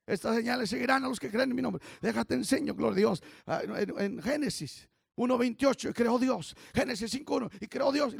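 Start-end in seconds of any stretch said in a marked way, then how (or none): none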